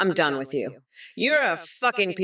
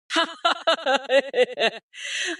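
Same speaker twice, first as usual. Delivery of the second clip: mocking burst of laughter; 5 semitones higher than usual. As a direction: cackle at the fool. Laughing at Trump's grunting.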